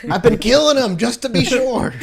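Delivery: a giggling little weirdo proud of his rats; goofy creature voice. Acted.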